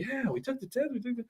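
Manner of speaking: a goofy chorus of dupes; mock crowd. Crowd agreeing with Jimmy, lightly high and silly. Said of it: "The entire crowd is like".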